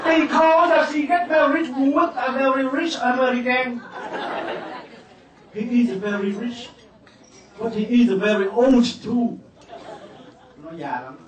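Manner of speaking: hammed-up toast from the bride's father; Quảng Nam accented English. Father at daughter's wedding toasts guests, hammed-up Quảng Nam accent. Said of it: "nói tiếng Anh mà ông nói bằng giọng Quảng Nam".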